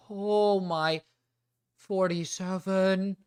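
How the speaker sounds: high, drawn-out dismay at the page count; dismayed gasp of disbelief; shocked. Real, not acted. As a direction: sound floored by the length. Reacting to long document length.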